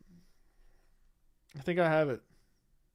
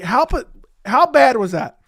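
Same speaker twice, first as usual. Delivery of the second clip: higher, much louder, incredulous; loud disbelief; 6 semitones higher, 8 dB louder than usual. Real, not acted. Streamer asking chat how bad the clip was, near shout.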